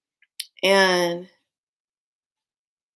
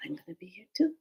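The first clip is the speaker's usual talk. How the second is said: whisper; 7 dB quieter, faster than usual. Retold or imitated. Reply whispered to child.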